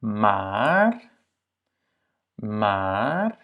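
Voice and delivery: maar drawn out with a rising tune; long A. Shown as a demonstration. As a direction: hold the A in maar. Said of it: "C'est aussi un A long". (« maar » avec un A long)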